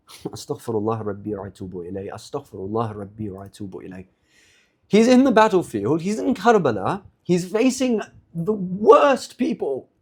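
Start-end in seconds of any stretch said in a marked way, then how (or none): 0.1-4.1 low, soft, quick prayer muttering; hushed, rapid, repetitive pious mumbling; mock pious murmur. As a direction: parody a meek holy man murmuring repentance. Mocks the imagined meek Imam in battle.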